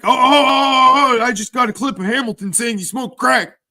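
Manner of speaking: high mocking imitation, wailed ohs rushing into words; Jesse. Imitating Jesse crowing over a clip of Hamilton, high mocking voice. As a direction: draw out the ohs high, then gabble excitedly. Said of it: "You know, Jesse's like".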